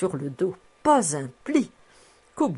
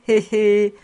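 chuckle; 4 semitones higher, 6 dB louder than usual. A narrator's reading. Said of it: "Elle riait de sa bouche édentée".

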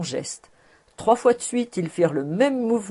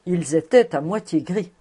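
usual speaking voice

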